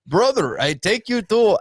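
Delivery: badly faked Russian accent; botched Russian-sounding accent. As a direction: attempt a cabbie's accent that comes out Russian. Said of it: "that's Russian".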